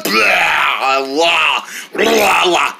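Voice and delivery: drawn-out spluttering blah; pretend spitting out. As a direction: noisily clear the mouth. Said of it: "Is that out of my mouth yet?".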